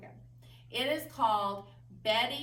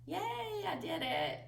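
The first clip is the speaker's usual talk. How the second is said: happy cheer; 9 semitones higher, 4 dB quieter than usual. Real, not acted. Cheers after finishing tongue twister.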